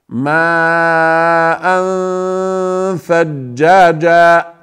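slow, drawn-out Quranic recitation of ماء ثجاجا, the ماء long and held; tajweed recitation. Shown as a demonstration. Teacher reciting for students, slow and drawn out. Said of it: "انظروا".

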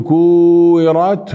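كورت with long oo and single w; missing shadda. Shown as a demonstration. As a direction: stretch the oo in كورت, no doubled waw. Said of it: "هنا ما شدد الواو". (كورت بمد الواو دون شدة)

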